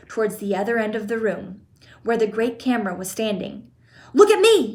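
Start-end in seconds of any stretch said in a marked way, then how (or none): 4.1-4.7 high loud shout while running. A narrator's reading. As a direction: yell it over your shoulder. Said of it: "he shouted as he ran".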